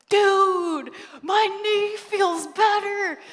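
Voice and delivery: loud, high, amazed cry. Retold or imitated. Healed teen tells his friends, loud amazed cry. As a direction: marvel loudly.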